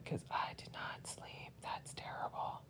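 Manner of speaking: tired whispered aside. Real, not acted. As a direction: drop to a breathy whisper, worn out.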